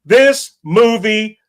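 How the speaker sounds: loud, slow, word-by-word verdict; emphatic disgust. Real, not acted. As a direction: hammer out each word in exasperation. Final verdict on hated movie.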